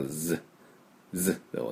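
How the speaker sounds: Z as a short buzzing consonant alone; voiced Z. Shown as a demonstration. Teacher showing the ending is voiced, buzzing Z. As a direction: buzz the final Z, no full vowel. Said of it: "濁ります".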